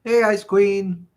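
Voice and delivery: greeting a viewer by name; sing-song greeting. Real, not acted. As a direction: call out a cheery welcome.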